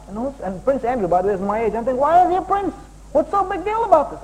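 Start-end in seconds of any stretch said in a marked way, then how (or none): 2.0-4.2 puzzled question. Retold or imitated. Child's puzzled question retold.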